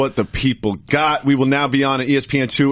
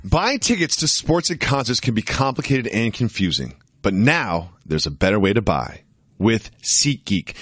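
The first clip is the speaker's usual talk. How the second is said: ad read; 3 semitones lower than usual. Acted. Host reads sponsor ad.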